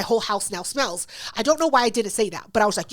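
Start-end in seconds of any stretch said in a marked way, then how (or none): none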